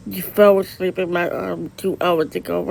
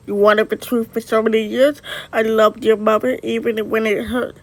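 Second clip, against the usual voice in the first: ordinary talk, nothing marked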